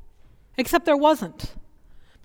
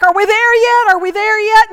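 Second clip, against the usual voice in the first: higher and louder, mock whiny kid chant; kids whining; 6 semitones higher, 7 dB louder than usual. Kids in the back seat nagging the driver, loud whine. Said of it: "the kids screaming in the back".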